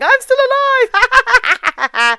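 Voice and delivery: high, slow, stretched mock yell with a laugh; laughing mock scream through a door; imitated shout. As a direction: ham up her being wheeled off screaming. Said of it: "she's shouting at the door going".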